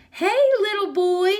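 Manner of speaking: put-on high, loud wheedling call to a child; sing-song high coax from the buggy; Bill coaxing. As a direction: lure the boy with a sugary high call. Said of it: "says Bill".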